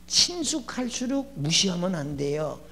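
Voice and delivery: normal talking voice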